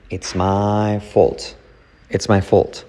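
usual speaking voice

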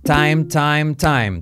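"time" said slowly with a full English ai glide; careful teacher demo of "time"; diphthong demo. Shown as a demonstration. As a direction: model "time" three times, gliding the ai. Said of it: "Mira las palabras que tienes en pantalla, las hacemos por tres".

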